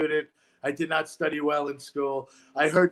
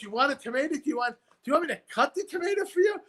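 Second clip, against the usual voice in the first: higher and fast, more and more baffled; incredulous repeated question; 8 semitones higher, faster than usual. Retold or imitated. Counter worker asking the odd customer again, higher and faster. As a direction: ask again, unable to believe the order. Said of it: "He goes".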